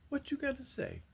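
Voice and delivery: high, soft teasing voice; a high, playful voice to the chicken. Real, not acted. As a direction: tease the hen playfully.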